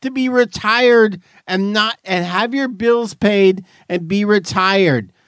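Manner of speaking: high, strained mock outrage; mock rant. Real, not acted. Host ranting at retirees in high pretend outrage. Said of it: "I'm pretending".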